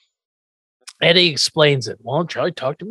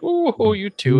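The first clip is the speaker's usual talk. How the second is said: teasing coo; 6 semitones higher than usual. Retold or imitated. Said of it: "he's like".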